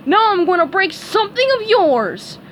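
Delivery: high, loud menacing voice; angry threat. A narrator's reading. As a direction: threaten like a monster. Patrick threatening SpongeBob.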